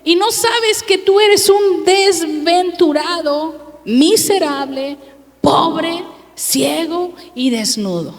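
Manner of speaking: strong rebuke. Acted. Preacher reading the Lord's words to the church, a slow stern rebuke. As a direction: lay it on slowly as a stern scolding. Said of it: "¿Sí le habla fuerte o no le habla fuerte?".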